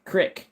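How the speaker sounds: "Crick" said with a short i, rhyming with "stick"; "Crick" with a short i like "brick". Shown as a demonstration. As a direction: clip "Crick" short, kr-IK, not creek. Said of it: "crick instead of creek".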